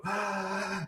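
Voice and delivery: big audible gasp of air; deep breath in. Shown as a demonstration. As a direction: suck in a huge breath before a long list. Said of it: "take a deep breath and go".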